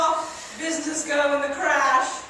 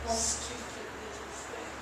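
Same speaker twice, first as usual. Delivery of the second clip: unvoiced snide whisper; mother mutters about her daughter; whispered aside; 8 semitones lower, 15 dB quieter than usual. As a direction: hiss it under your breath.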